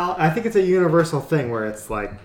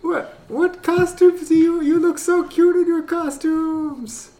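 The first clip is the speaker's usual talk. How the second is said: silly high voice; 3 dB louder than usual. Pretend. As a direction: squeak it out like a cartoon neighbor. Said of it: "there's Obert's voice".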